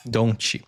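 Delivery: "Don't" pronounced "don-chi". Shown as a demonstration. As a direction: show "Don't" with a Brazilian "chi" ending. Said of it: "the T is like a chi sound".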